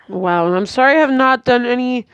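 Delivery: usual speaking voice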